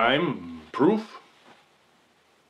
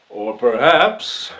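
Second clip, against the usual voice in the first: loud, slow courtroom flourish; dramatic turn; 8 dB louder than usual. Acted. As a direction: swell up and hold it.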